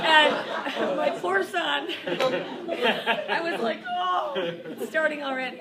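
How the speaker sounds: telling the group about her son while laughing through it; laughing hard. Real, not acted. Laughing loudly through her words.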